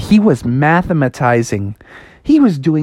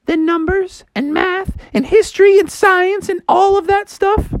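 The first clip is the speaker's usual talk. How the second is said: high-pitched mom pep talk; high, emphatic mock-motherly encouragement; Froggy's inspirational mom. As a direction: preach self-belief in a high voice.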